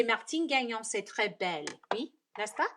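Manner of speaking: French accent with a questioning tag at the end. Shown as a demonstration. Crafter complimenting Martine's name in French, French accent. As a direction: say it all in French, checking for agreement. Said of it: "don't laugh at my accent".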